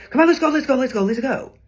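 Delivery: high, loud rushed calls; loud, high pressing urgency; urgent hurry. Shown as a demonstration. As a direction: hurry everyone out the door now. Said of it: "sense of urgency with that tone and how we did it in our cadence".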